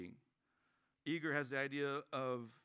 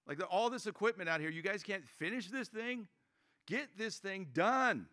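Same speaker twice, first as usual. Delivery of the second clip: impatient griping; 6 semitones higher than usual. Retold or imitated. Driving past the unfinished Bojangles, mock-exasperated griping. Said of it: "I'm like".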